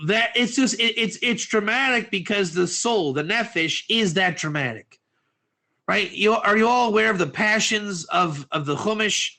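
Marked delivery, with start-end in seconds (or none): none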